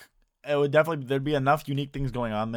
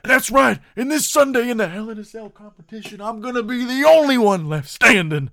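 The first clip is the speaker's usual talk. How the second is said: screamed much higher and louder; Macho Man impression; 10 semitones higher, 9 dB louder than usual. Imitating Randy Savage's random screaming promo. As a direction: yell it out, then drop back. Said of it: "He'll be like".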